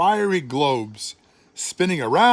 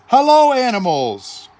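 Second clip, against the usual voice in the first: high, loud welcome; joyful greeting; 7 semitones higher, 7 dB louder than usual. A narrator's reading. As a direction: call the animals out to play. God greets creation joyfully.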